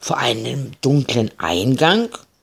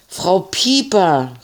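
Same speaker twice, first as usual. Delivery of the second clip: calling out; 8 semitones higher, slower than usual. A narrator's reading. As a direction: call to the neighbour on the ground. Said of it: "riefen beide".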